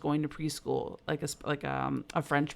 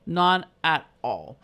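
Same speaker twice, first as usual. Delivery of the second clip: "None at all" drawn out slow and louder; slow emphatic echo; 5 dB louder, much slower than usual. Real, not acted. Mother agreeing with her friend, slow and emphatic. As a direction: stretch each word, louder, to insist.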